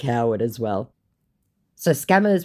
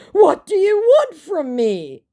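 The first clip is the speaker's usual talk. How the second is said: much higher, slow, put-on pleading; mock begging; 7 dB louder, slower than usual. Scammer begging her, hammed-up high plea. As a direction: beg in a silly high voice. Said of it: "he finally begs".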